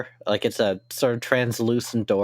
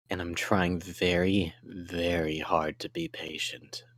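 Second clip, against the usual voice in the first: menacing low whisper; 5 semitones lower, 8 dB quieter than usual. Acted. GM voices menacing NPC, low whisper.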